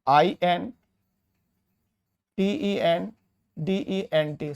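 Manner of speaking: slow spelling, one letter at a time. Shown as a demonstration. Teacher dictating superintendent spelling to students, slow. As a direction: dictate each letter with pauses. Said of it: "आपको जो लिखना है".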